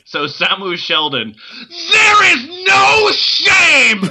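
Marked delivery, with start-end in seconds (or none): 1.9-4.0 high, slow, ranting cry; over-the-top shouting. Pretend. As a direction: bellow it like a street preacher. Reading a profile at full force.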